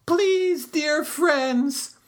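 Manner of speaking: very high, slow begging cry; desperate high plea; upset pleading. A narrator's reading. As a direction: beg them not to leave. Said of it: "Turtle was very upset and cried".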